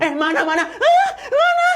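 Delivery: very high, loud frightened shriek, laughing; soft-spoken act broken by a shrieking laugh; scared scream. Acted. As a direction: shriek at the ghost, forgetting to be soft. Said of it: "Udah nggak soft spoken dia".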